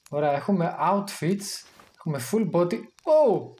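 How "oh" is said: sudden high startled oh; short startled exclamation; surprised oh. Real, not acted. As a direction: caught off guard by something on screen.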